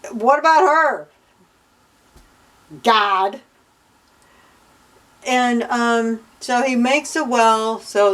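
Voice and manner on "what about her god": indignant protest. Real, not acted. Reader objecting on behalf of the forgotten mother, indignant. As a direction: demand an answer, exasperated.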